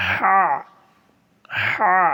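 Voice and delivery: হা squeezed from the throat; throaty ha. Shown as a demonstration. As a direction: tighten the throat on হা. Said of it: "এইভাবে".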